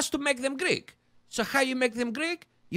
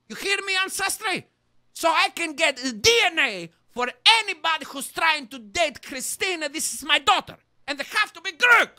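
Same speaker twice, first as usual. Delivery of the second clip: loud, high shouting at the sponsor; screaming; 3 semitones higher, 7 dB louder than usual. Acted. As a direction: yell it like an overprotective father. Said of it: "I'm going to be screaming".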